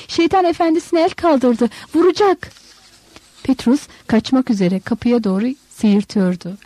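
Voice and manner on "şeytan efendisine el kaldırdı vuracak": high-pitched warning; narrated woman's high, urgent call; alarmed cry. A narrator's reading. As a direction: cry out that danger is coming. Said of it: "diye bağırıyordu Maria".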